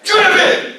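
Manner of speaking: Driven shouted high and loud; emphatic word. Shown as a demonstration. Speaker to the audience, naming his word with a loud stressed shout. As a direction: punch out Driven like a rallying cry. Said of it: "This word that is floating in my mind".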